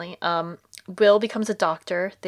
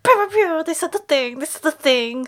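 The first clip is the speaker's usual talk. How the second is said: high, louder playful mimicry; gleefully parroting the show; mock excited; 7 semitones higher, 5 dB louder than usual. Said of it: "I was like".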